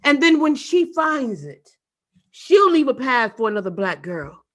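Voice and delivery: loud recital, first line slowed; ringing poet's delivery; spoken word poem. Acted. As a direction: keep proclaiming the refrain.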